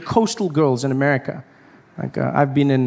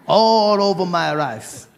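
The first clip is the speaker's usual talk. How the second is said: slow English in mock Japanese accent; telling where he learned, slow mock Japanese accent; slower than usual. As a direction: answer in a put-on Japanese accent. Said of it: "I said".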